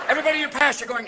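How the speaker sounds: normal talking voice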